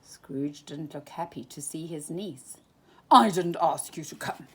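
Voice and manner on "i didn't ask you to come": put-on louder, cross old miser; grumpy shout. A narrator's reading. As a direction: snap at the unwanted guest. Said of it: "he shouted".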